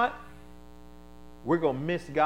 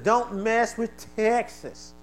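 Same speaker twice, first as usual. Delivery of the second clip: drawn-out slogan; 8 semitones higher, 5 dB louder, much slower than usual. Retold or imitated. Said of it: "bumper sticker and said".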